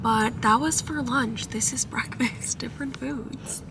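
soft embarrassed whisper. Real, not acted. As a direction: whisper it so nobody hears. Embarrassed in Chick-fil-A line.

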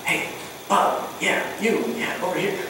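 hushed, breathy call across the room; discreet whisper. Retold or imitated. Host discreetly calling a guest aside, in a whisper. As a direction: whisper it so nobody else hears. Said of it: "super discreet by saying".

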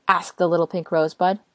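plain reading voice

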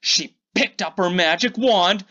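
ordinary delivery

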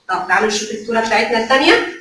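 ordinary delivery

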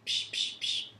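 soft unvoiced spray noises; soft psh of a spray bottle; spray hiss. Shown as a demonstration. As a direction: imitate a perfume spray. Spraying perfume sound.

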